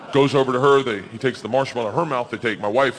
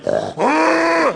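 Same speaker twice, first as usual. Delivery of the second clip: high-pitched bear noise; light up like a bear seeing a treat; excited bear; 4 dB louder than usual. Acted. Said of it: "lights up just like".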